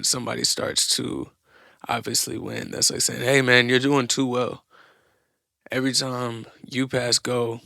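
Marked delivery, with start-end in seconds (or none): 3.2-4.6 loud mock protest at a winner; raised-voice complaint. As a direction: call out like a griping rival player. Acted complaint to a winning player.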